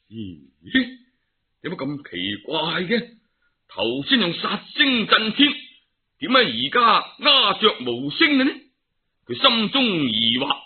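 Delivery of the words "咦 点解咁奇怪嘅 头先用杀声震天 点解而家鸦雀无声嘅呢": puzzled wonder. Acted. Monkey King puzzled by silence.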